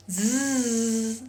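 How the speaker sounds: Z as a long voiced buzz; isolated consonant sound. Shown as a demonstration. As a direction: buzz out Z alone, no vowel. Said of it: "I am going to pronounce".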